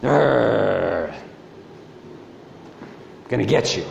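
growling dog. Retold or imitated. Tiny tied-up chihuahua threatening the family, low mock growl. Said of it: "looks at us and goes".

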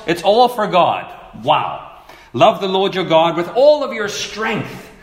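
insistent preacher, swelling on every key word; emphatic preaching. Real, not acted. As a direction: drive the verse home phrase by phrase. Preaches the commandment phrase by phrase.

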